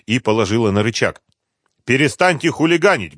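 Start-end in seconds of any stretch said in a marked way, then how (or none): 1.9-3.2 higher, raised-voice reprimand; mildly loud rebuke, pitched up; loud scolding. A narrator's reading. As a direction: tell them off firmly. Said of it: "громко сказала она".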